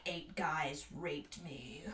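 low, very slow, drawn-out mock monologue; imitated drunken rambler. Retelling housemate's weird stories, imitated rambling monologue. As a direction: drag it out low, imitating his rant. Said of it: "combine it with his weird".

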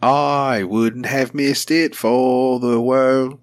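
slow, half-sung Australian accent. Shown as a demonstration. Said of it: "You just use your Australian accent, and you're like".

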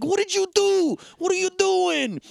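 high, frantic mock yell; retold yelling. Imitating John Pankow's character yelling at his wife, frantic mock yell. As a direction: shoot way up in pitch, imitating a yeller. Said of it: "he just keeps yelling at her".

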